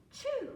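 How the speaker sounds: gasp, then a high, loud "choo"; mimed sneeze. A narrator's reading. Storyteller as the duck catching cold, mimed high sneeze. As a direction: act out the duck's sneeze.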